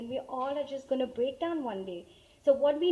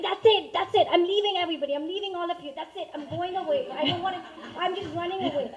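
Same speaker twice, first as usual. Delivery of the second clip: higher, much louder frantic outburst; meltdown outburst; 5 semitones higher, 6 dB louder than usual. Retold or imitated. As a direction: blow up at the whole family. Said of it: "I just wanted to melt down".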